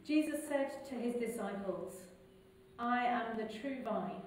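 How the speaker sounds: ordinary delivery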